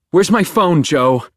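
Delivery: loud, high angry demand; shouting. Acted. Beck yelling at Joe for her phone, shouting. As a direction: yell it at him, furious.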